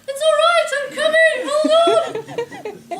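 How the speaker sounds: full-volume, high-pitched rescue yell; shouted reassurance. Retold or imitated. Rescuer answering a scream from beyond the fences, shouting. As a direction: holler across the back gardens at the top of your voice. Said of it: "kept on shouting".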